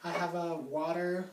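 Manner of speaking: ordinary talk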